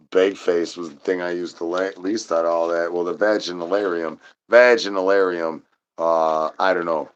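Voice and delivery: normal talking voice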